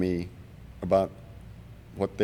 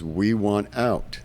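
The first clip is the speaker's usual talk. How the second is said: slow insistent demand; much slower than usual. Retold or imitated. One zero demanding extraction, slow and insistent. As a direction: drag each word out slowly. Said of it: "He says".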